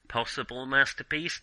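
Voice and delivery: ordinary talk, nothing marked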